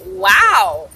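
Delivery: loud stunned wow; shocked wow. Retold or imitated. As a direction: blurt it out in shock. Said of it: "I'm like".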